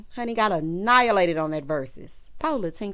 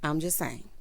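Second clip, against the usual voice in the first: ordinary delivery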